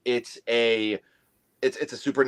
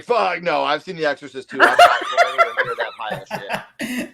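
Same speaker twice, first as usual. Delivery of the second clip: loud, higher retold outburst breaking into laughter; acted indignant refusal; 8 semitones higher, 6 dB louder than usual. Telling friends how he refused the third film, mock-indignant outburst. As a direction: replay your outraged refusal, climbing very high at the end. Acts out his refusal to watch.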